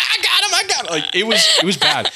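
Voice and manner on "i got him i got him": high-pitched, excited country holler; hammed-up country accent, pitched way up. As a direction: squeal it like a country boy swatting bugs. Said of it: "it was, like, Country Bill".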